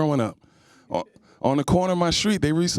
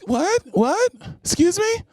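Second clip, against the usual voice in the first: high rising outraged "what?", much higher; indignant taken woman; 13 semitones higher, slower than usual. Retold or imitated. Impression of a taken woman at the club, high indignant outrage. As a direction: bristle as if someone touched you. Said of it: "my impression of women who are in relationships".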